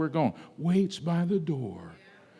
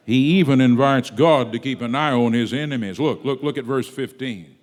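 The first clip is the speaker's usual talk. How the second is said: ordinary delivery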